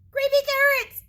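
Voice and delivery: high, loud scared yell; terrified shout. Acted. Bunny shouting at the carrots on his wall. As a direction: shout it in terror. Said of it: "he shouted".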